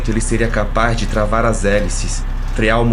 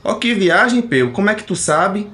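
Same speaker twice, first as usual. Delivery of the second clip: much higher, amazed regular; astonished customer; 9 semitones higher than usual. A narrator's reading. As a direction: squeal in surprise like Osmar. Osmar answers Peu.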